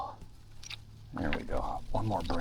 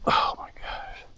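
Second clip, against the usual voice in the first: whispered; slower than usual. Real, not acted. After zigzagging through the creek.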